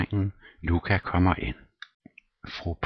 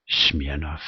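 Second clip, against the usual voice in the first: plain reading voice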